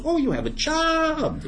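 high, drawn-out mock amazement; feigned surprise. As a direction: stretch it out slowly, as if delighted by the discovery. God feigns surprise again.

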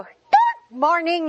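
stretched-out, high perky "Good morning"; perky greeting. Retold or imitated. Flight attendant greets sleepy passengers in a drawn-out perky voice. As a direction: sing it out like a chirpy flight attendant. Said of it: "they go".

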